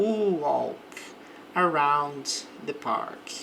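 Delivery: "walk" said with a rounded o, slowly; slow "walk around the park", al as o; walk with an O sound. Shown as a demonstration. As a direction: model "walk" with round lips. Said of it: "o A ele tem mais som de O".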